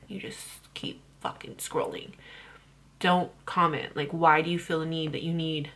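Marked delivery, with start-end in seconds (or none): 0.1-2.1 dismissive whisper, much softer. Real, not acted. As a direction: hush it out like a throwaway. Dismissive advice to haters.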